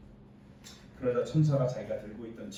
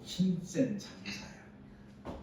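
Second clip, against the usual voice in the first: hushed slow address; 8 dB quieter, much slower than usual. Acted. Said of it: "이렇게 부른 것도 아니고".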